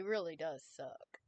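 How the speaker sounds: plain reading voice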